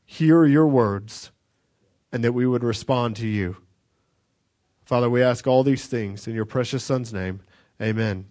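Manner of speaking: closing prayer. Acted. Pastor closing prayer to congregation, hushed. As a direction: pray softly over the congregation.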